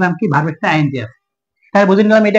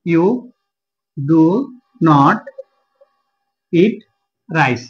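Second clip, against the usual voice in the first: each word alone, long pauses between; word-by-word dictation; much slower than usual. Shown as a demonstration. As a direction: spell out the sentence slowly, word by word. Repeats example word by word while writing. (একটা একটা শব্দে থেমে থেমে বলা)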